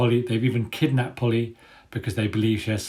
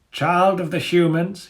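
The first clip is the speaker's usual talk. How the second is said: much higher, louder grand declaration; declaring dog leader; 8 semitones higher, 5 dB louder, slower than usual. Acted. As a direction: proclaim it like a menacing ruler. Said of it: "he declares".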